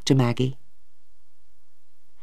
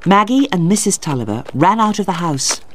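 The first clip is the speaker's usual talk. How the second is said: ordinary delivery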